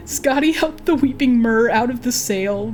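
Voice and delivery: plain reading voice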